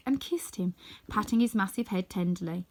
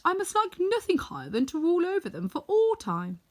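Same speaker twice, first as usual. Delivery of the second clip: higher, louder Tin Woodman, fond and earnest; 6 semitones higher, 4 dB louder than usual. Acted. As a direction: speak as the Tin Woodman, stretching out his fondness.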